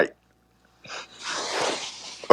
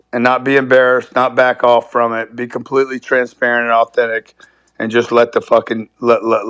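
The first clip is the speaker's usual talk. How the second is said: ordinary talk, nothing marked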